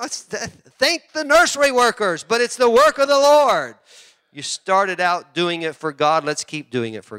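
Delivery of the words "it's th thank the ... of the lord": loud, high, emphatic preaching. Real, not acted. Pastor to church workers, preaching loudly. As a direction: drive the point home.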